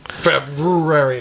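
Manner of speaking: February with a long, hammered "r" in the middle; overpronounced R. Shown as a demonstration. Co-host corrects the pronunciation, overpronounced R. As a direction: stretch out February, leaning hard on its R. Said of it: "There's an R in there".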